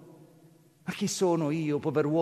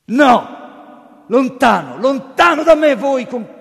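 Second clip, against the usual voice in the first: high, loud, desperate refusal; loud higher cry, breaking off; shouting; 9 semitones higher, 12 dB louder, slower than usual. Acted. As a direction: push him away with your voice. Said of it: "gridò questo".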